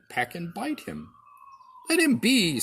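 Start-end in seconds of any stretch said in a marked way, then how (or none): 1.9-2.6 higher and louder, a protective mother's command; firm mother duck. A narrator's reading. Mother duck shielding her duckling, firm and louder. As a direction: stop them with a raised mother's voice. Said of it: "said Mother Duck".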